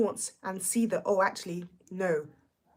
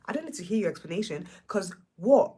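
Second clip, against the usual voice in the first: ordinary talk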